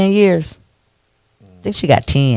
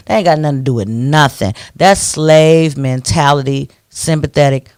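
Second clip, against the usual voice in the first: normal talking voice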